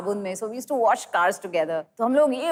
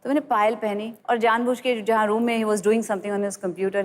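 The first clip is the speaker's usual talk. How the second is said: usual speaking voice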